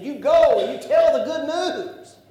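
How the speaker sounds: loud, high preaching command; shouted command. Acted. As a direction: proclaim it like a charge to go. Jesus commands disciples, preacher shouts.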